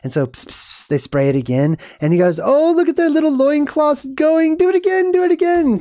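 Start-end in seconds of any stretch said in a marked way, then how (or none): 2.4-5.8 very high, squealing mock glee; hammed-up falsetto delight; delighted God. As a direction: beg for more like a thrilled child. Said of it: "excited and stuff. And God's loving it".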